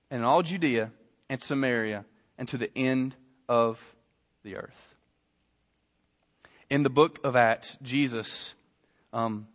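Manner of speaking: low, soft, solemn sermon voice; quiet, weighty scripture delivery; hushed preaching. Real, not acted. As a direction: bring it right down and let it sink in. Hushed teaching and scripture reading.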